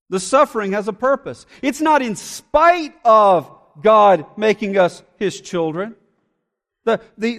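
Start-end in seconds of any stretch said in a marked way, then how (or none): none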